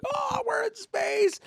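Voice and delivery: slow, high-pitched squeal of wonder; thrilled kid. Retold or imitated. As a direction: squeal it like an amazed child. Kid thrilled at reaching space.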